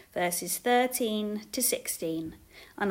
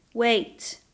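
louder single-word call; 6 dB louder than usual. A narrator's reading. Jesus stopping the family leaving, calling out. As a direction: call across a crowd. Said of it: "another voice called out across the crowd".

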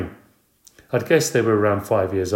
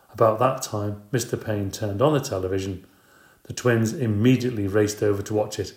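plain reading voice

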